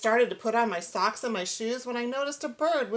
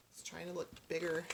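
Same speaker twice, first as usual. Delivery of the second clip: hushed aside; 5 semitones lower, 12 dB quieter, faster than usual. Real, not acted. Aside to child listener.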